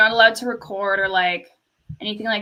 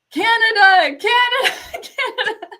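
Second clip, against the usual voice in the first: high, loud repeated shouts breaking into laughter; retold yelling; 12 semitones higher, 6 dB louder than usual. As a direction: holler it from the crowd, then crack up. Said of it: "I can yell really".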